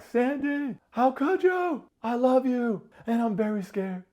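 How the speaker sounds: very high, loud mock wailing; hammed-up heartbroken crash out; mock meltdown. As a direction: imitate a man falling apart, shrieking high. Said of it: "Nick crash out".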